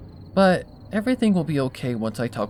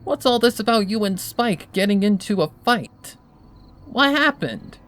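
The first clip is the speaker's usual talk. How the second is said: higher, louder, in your face; demanding Rainbow; 9 semitones higher, 5 dB louder than usual. A narrator's reading. Rainbow in Twilight's face, demanding answers loudly. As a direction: press her for answers.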